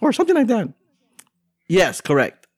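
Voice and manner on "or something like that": very high cartoon reporter falsetto; Nikki Moss. Theme park correspondent explaining the bang to hosts, high cartoon falsetto. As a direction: report it in a squeaky mouse voice.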